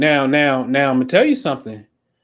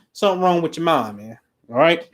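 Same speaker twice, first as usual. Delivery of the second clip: ordinary talk, nothing marked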